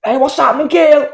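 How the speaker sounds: loud, high-pitched hammed-up catcall; mock catcall.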